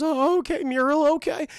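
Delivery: high, loud wobbly dog voice; hammed-up nervous compliance; timid cartoon dog. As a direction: imitate an anxious dog agreeing. Nervous dog answers owner.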